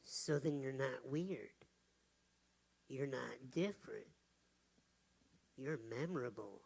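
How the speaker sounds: low, soft and reassuring. Retold or imitated. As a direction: tell her gently she's special. Speaker reassures the child softly.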